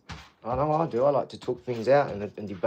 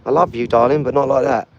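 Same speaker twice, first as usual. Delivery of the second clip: firm loving scold; 12 dB louder than usual. Real, not acted. Puppy jumping up.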